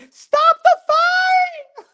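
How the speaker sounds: very high, loud, slow laughing scream; mock screaming wife. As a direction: shriek it like a panicked wife. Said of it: "screaming like Apollo Creed's wife".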